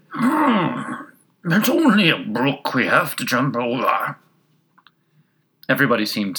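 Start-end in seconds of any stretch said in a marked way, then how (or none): 0.1-4.2 slow, whinnying horse. A narrator's reading. Horse reassuring the carriage about a brook, whinnying voice. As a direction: snort first, then draw the words out like a horse. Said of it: "quietly drew it in and said".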